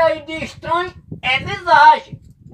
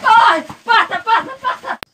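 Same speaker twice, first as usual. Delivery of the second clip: high, frantic shouts of terror; panicked cries; 3 semitones higher, slower than usual. Acted. Terrified digger flees the creature, crying out in panic. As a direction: cry out as if fleeing for your life.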